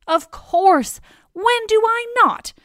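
high, loud, emphatic certainty; matter-of-course. A narrator's reading. Heracles asked about craving pea soup, emphatically matter-of-course. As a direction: answer as if it's utterly obvious. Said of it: "as though that's the most normal question".

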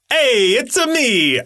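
drawn-out Italian plumber catchphrase voice; Chris Pratt as Mario. Acted. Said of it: "Chris Pratt being like".

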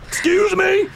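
high, incredulous retort; affronted cop. Acted. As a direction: react in offended disbelief. Cop taken aback by request.

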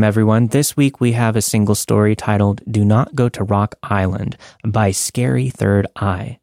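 plain reading voice

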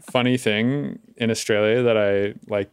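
normal talking voice

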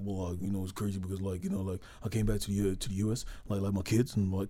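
low, soft mumbling Rodman voice; lightly voiced rambling Dennis Rodman; Dennis Rodman impression. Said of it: "Dennis is like".

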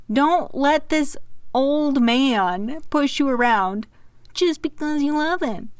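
high, slow egging-on voice; mock pep talk. Real, not acted. Cheering Mia on from afar in a high, slow, sassy voice. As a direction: cheer her on like a sassy friend.

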